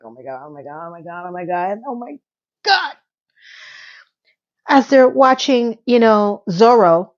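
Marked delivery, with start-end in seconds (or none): 0.0-2.9 breathy, soft swooning oh my God; mock swooning. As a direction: swoon over it, hushed. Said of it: "I'm like".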